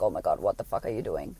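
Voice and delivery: low, hushed mock panic; imitating shaken husband. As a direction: drop low and soft, aghast. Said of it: "he's just, like, shaking now".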